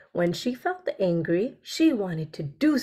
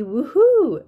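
louder, higher little cheer; excited woohoo; 5 semitones higher, 7 dB louder than usual. Shown as a demonstration. Teacher lists excited words, cheering. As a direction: cheer it for the class.

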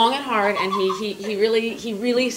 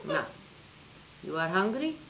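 put-on soft accented question; quietly menacing Hungarian grandma; 12 dB quieter than usual. Grandma to granddaughter after the slam, Hungarian accent. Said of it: "very thick accent".

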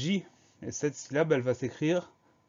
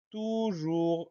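word stretched out syllable by syllable, higher pitch; slow dictation; 5 semitones higher than usual. Shown as a demonstration. Teacher giving learners the next word, slow dictation. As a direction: announce it very slowly, higher.

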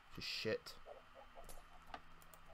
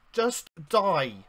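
loud final yell of rage; loud shout; 5 semitones higher, 14 dB louder than usual. Real, not acted. Gamer shouting at the witch as he attacks her, loud. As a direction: bellow it as you strike. Fighting the enemy.

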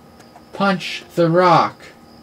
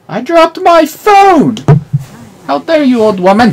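mock outrage; 11 semitones higher, 11 dB louder than usual. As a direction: ham up the offense.

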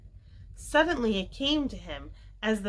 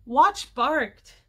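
loud, raised-pitch exclamation; excited cry; 5 semitones higher, 7 dB louder than usual. A narrator's reading. Jessie and Henry remembering the dog, excited cry. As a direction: call out the memory. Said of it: "cried Jessie and Henry".

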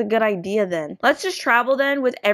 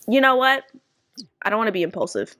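ordinary talk, nothing marked

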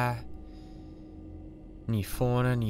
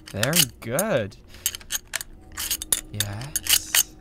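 pleased; much slower than usual. Acted. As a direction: savour it. Scientist pleased.

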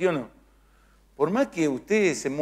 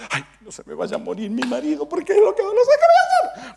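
very high, hammed-up fretting wife; mock anxious wail; 13 semitones higher, 5 dB louder than usual. Wife dreading her husband's death, mock wailing. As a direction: wail in exaggerated worry, climbing in pitch.

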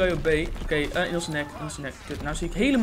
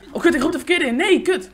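loud, high-pitched panic at a mistake; flustered, raised-voice swearing; alarmed cursing; 8 semitones higher, 6 dB louder than usual. Real, not acted. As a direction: curse your own blunder in real alarm. Calls in the wrong scorestreak.

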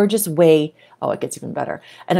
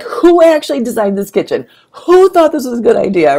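louder, exasperated rant; frustrated; 6 semitones higher, 7 dB louder than usual. Retold or imitated. Quoting herself fed up with the kitchen, louder. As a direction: vent it, annoyed.

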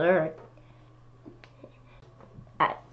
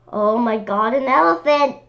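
surprised exclamation; 5 semitones higher, 4 dB louder than usual. Pretend. Reader exclaims at elephant.

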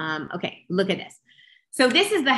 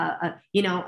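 ordinary talk, nothing marked

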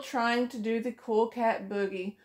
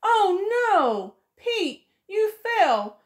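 oh no, higher and louder; startled turtle; 10 semitones higher, 5 dB louder, slower than usual. Acted. Turtle alarmed when Pete trips over his shell. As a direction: turtle gasping as Pete tumbles.

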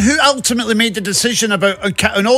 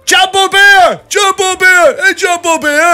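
louder, higher yell of a fan across an arena; mock crowd shout; 10 semitones higher, 6 dB louder, slower than usual. Retelling fans at the arena, yelling. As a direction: call it out like a fan across the hall. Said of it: "people shouting across the hydro".

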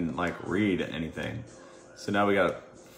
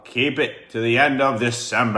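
raised, louder repeat; 4 semitones higher, 5 dB louder than usual. Real, not acted. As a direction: speak up so everyone hears. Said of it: "You gotta speak up".

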